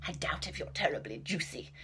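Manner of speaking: hushed, menacing rat; sinister whisper. Acted. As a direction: whisper the threat.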